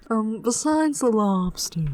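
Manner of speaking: slow, drawn-out silly voice; silly put-on voice. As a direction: drag it out in a goofy voice. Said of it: "I love s-, talking in that voice".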